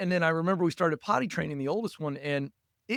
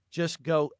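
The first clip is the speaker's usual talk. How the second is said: ordinary talk, nothing marked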